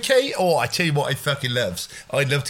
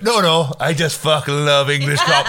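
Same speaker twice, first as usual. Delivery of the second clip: put-on insistent Welsh girl; 5 dB louder than usual. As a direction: imitate her cutting in to insist. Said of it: "she went".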